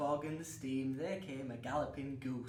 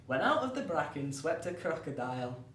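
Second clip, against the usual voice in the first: ordinary talk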